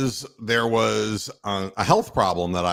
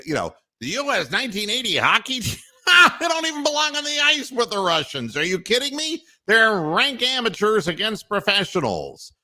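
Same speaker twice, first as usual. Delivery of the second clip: much higher, shouting, fast, with a laugh; mock outraged scoffer; 7 semitones higher than usual. Pundit dismissing the US hockey team, with hammed-up scorn. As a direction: scoff like a loudmouth who thinks it's hopeless. Mocking doubters of the 1980 team.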